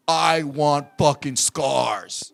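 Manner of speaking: low, very slow, each word weighted; insistent grave demand. Retold or imitated. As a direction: repeat the demand slowly, leaning on "fucking". Vince repeats demand more forcefully.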